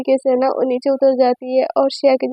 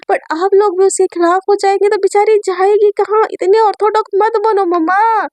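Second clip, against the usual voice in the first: higher, earnest pleading brother; brother's voice; 4 semitones higher than usual. Acted. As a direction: plead with mum, pitched up and earnest. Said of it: "उसका भाई बोलता है कि".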